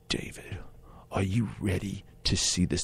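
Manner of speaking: hushed, breathy build-up to a reveal; teasing whisper. Retold or imitated. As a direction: whisper it like a secret. Director teases the reveal.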